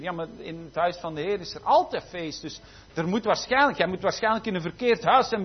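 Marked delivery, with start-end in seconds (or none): none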